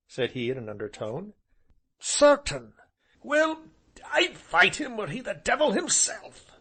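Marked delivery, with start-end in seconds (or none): 2.0-6.5 high, loud bluster; defiant gentleman. Acted. Sir Harry defying his friend, loud and high. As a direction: refuse to back down. Companions argue in voiced roles.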